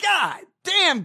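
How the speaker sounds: high furious shout of God damn; fed-up shouted curse; angry shout. Real, not acted. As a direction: yell it in exasperation. Ranting at Democrats.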